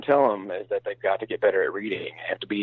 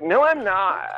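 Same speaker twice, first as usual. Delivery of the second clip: higher mock California-girl denial, creaky ending; imitated vocal-fry girl; 9 semitones higher, much slower than usual. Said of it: "They have that vocal fry".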